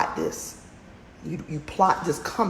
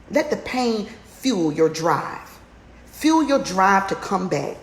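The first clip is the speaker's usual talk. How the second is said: slow emphatic urging; 2 semitones higher, slower than usual. Real, not acted.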